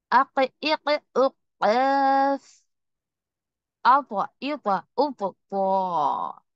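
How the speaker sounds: syllables said slowly, each held and stopped hard; makhraj drill. Shown as a demonstration. Teacher drilling articulation points for students, slow and stopped. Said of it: "जैसे मैं बोल रही".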